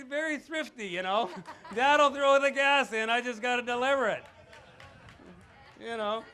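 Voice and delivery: loud and high, laughing through the words; laughing loud teasing. Real, not acted. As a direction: tease your daughter while cracking up. Father jokes about thrifty daughter.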